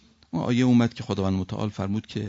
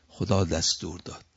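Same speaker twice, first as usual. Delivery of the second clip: usual speaking voice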